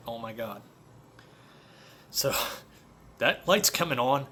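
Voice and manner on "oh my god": a soft, hushed "Oh my God"; hushed dismay. Retold or imitated. Hearing that his buddy's oil light was flashing, a hushed "Oh my God". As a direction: breathe it out quietly in disbelief.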